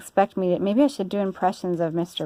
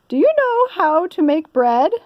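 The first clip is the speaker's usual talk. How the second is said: high, louder fussy lady voice; Missus Rogers; 10 semitones higher, 6 dB louder than usual. Acted. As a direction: bustle like a busy mistress. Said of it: "said Missus Rogers".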